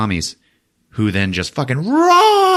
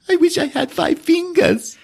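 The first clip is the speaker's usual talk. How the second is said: put-on sad kid voice. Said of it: "Oh, zombies".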